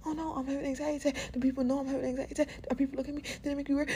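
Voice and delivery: fast, high, frantic self-talk; panicky inner voice. Retold or imitated. Podcaster voicing the anxious mind, fast and panicky. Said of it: "that can make you more anxious".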